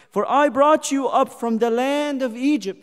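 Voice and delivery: full-voiced pulpit proclamation; proclaimed verse. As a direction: proclaim it to the hall. Same verse proclaimed loud.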